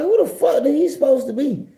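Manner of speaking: high-pitched retold disbelief; mock-indignant, voice jumping high; indignant question. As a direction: pitch it way up, incredulous. Retold indignant question about the man.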